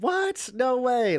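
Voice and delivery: retelling his stunned reaction to Whitey Bulger's arrest; mock shocked disbelief. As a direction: jump up in pitch like the news floored you. Retold reaction to shocking news.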